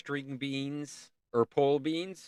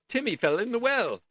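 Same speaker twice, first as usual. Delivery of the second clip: Lassie TV cry; 9 semitones higher, 4 dB louder than usual. Retold or imitated. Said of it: "For those of you who know Lassie".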